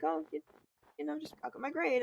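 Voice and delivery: high, soft, quick excuse; mock timid. Student excusing herself to mean teacher, mock timid. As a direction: imitate a nervous student's small-voiced reply. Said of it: "I was terrified of her".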